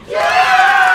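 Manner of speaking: a sudden high, loud whoop of victory; triumphant cheer. Acted. Winner of the art award whoops with joy. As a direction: burst out cheering as your name is called.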